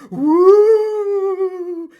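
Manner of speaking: loud high ghostly wail; ghost woo. Acted. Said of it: "and it went".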